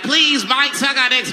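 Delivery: put-on high pleading kids' voice; begging kids. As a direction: beg like eager children. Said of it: "all the kids like".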